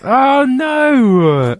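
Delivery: long, high, loud-ish wail of "oh no"; hammed-up horrified cry; mock dismay. Mock dismay at supposed cut moment.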